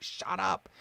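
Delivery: retold irritation. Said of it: "really ticks me off".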